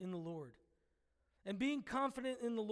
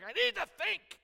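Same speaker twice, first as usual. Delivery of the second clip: much higher and louder, fed up; exasperated Moses; 8 semitones higher, 7 dB louder than usual. Retold or imitated. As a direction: snap it out at the whining crowd. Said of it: "and he's like".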